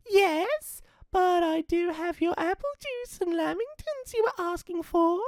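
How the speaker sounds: put-on a wolf faking a sweet child; sugary fake little girl; nicest voice. Dingo faking Red.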